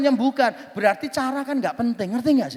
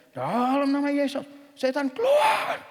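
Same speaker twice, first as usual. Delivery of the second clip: exorcism command; 3 semitones higher than usual. Retold or imitated. Hamba Tuhan ordering out a demon, mock exorcism command. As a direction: imitate a preacher commanding, climbing steeply in pitch. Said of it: "Dia tingkatkan lagi intonasi dan volumenya".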